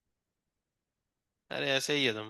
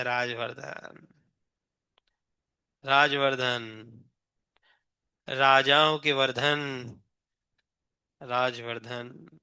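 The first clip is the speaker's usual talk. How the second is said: 1 slow savouring; 6 semitones lower, 4 dB quieter, much slower than usual. Real, not acted. Teacher repeats name, drawing it out.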